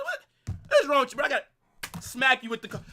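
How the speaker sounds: ordinary talk, nothing marked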